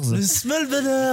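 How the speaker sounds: put-on high, very drawn-out French-accented echo; slow put-on French title; mock French accent. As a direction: stretch it out in fake French English.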